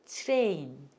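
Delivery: TRAIN with tr blended into ch, "chrein"; model pronunciation. Shown as a demonstration. Teacher leading students to repeat 트레인, model pronunciation. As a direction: say 트레인 as "chwrein", one smooth glide. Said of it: "따라서 해보세요".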